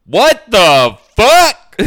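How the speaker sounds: loud, slow, high what the fuck; stunned at what you just heard; shocked outburst. Real, not acted. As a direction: friend reacting to the clerk's comment, stunned and loud. Listener shocked by comment.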